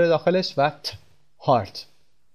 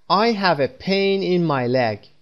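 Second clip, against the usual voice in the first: very slow and higher, word by word; slow model sentence; 4 semitones higher, much slower than usual. Shown as a demonstration. Teacher modelling the correct sentence, very slow and higher. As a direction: lay each word out slowly for learners. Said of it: "من در پاهام احساس درد دارم".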